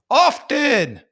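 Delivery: "Often" said with the T sounded, high and loud; outraged demo of the T in "Often"; pronounced T in often. Shown as a demonstration. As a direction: shout out "Often" with the T. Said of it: "He says the T and often".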